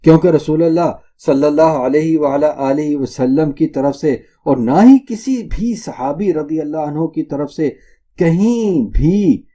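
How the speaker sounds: ordinary delivery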